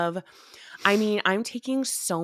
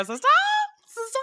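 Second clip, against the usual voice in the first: high shrieking witch cry; witch call; 3 dB louder, faster than usual. Retold or imitated. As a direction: screech it like a Hocus Pocus witch. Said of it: "every time I wanna go".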